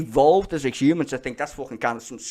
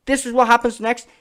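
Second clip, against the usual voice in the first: louder, higher repeat; 10 semitones higher, 9 dB louder than usual. Shown as a demonstration. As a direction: shout it again, higher and louder.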